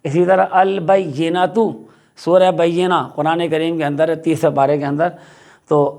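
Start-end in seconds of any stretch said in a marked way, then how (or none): none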